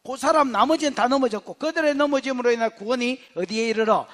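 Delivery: ordinary delivery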